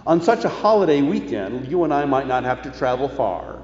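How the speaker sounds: normal talking voice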